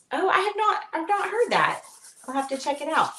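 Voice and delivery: mock office lady. Voicing office lady on phone.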